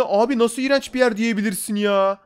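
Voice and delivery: usual speaking voice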